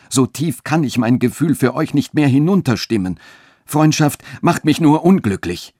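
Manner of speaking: höher und lauter, glühend; in höchster Leidenschaft. A narrator's reading. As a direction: mit ganzer Leidenschaft herausgestoßen. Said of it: "in höchster Leidenschaft". (Friedrich bestürmt Katharina, höher und lauter, voller Leidenschaft)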